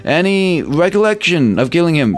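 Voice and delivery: high, earnest protest; flustered officer denial. Acted. Officer insisting to the detective he meant no killing, high and earnest. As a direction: swear you never meant it, sir.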